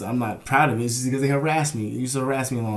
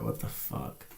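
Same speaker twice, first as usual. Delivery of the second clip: low unvoiced whisper; 11 semitones lower, 11 dB quieter, slower than usual. Real, not acted. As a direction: breathe it under your breath. Whispered aside.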